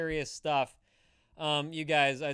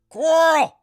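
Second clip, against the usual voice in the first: very high, loud yell of Coral; shouted Coral with a Rick accent; Rick calling Carl; 16 dB louder than usual. Retold or imitated. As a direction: call out Coral across a yard, frantic. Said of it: "he said Carl weird too".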